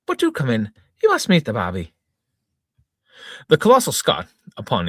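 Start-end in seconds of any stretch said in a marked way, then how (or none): 0.1-1.9 brightly welcoming, feigning calm; chirpy hostess. Acted. Wife welcoming the giant, mock chirpy. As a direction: sweetly invite the giant in. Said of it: "she chirped".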